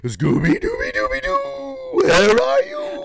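very high cartoon dog voice; Scooby-Doo. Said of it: "that was a dog voice".

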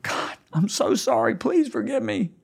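high, pleading cry to God; anguished plea. Retold or imitated. Pastor retelling his desperate prayer on the plane, high pleading. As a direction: beg forgiveness in a high strained voice.

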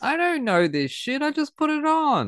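high-pitched squeal of protest; mock protest. Mock protest about ignoring album.

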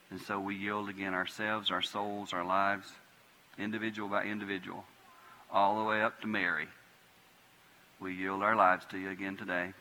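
soft, quiet prayer voice. Real, not acted. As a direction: pray it low and hushed, pausing. Closing prayer at the altar.

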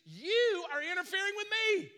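high, loud demon shriek; loud, high demon cry. Retold or imitated. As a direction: screech it at Jesus like the demon. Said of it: "demon spirit shows up and says to Jesus".

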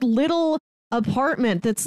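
ordinary talk

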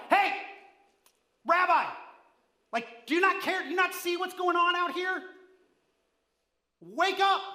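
high, loud, frantic yelling at Rabbi; terrified disciples yelling at their sleeping teacher; panicked shout. Shown as a demonstration. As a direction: shake him awake, shouting over the storm. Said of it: "read that a little bit too soft than what they would have stated".

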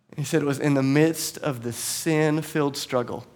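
ordinary talk, nothing marked

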